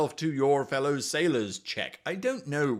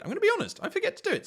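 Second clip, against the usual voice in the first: much higher, sheepish squeak; squeaky high confession; much faster than usual. Real, not acted. Sheepish admission said twice.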